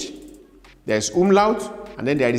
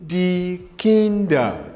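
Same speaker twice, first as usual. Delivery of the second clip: slow, drawn out die Kinder; slow drill; 2 semitones higher, much slower than usual. Shown as a demonstration. Teacher drills plural slowly, drawn out. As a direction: stretch die Kinder for repetition. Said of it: "we pronounce the word".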